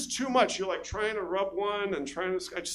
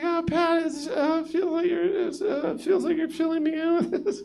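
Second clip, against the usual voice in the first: high, louder moaning lover voice; moaning woman in bed; 11 semitones higher, 4 dB louder than usual. Retold or imitated. Comic mocking the woman in bed, high moaning. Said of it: "She's like".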